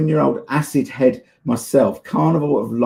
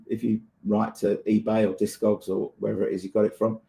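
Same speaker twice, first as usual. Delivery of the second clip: ordinary delivery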